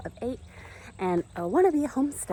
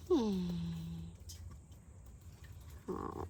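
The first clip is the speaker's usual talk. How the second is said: hushed soothing; 16 dB quieter, slower than usual. Real, not acted. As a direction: barely voice it, soothe the little one. Soothing baby Welby, very softly.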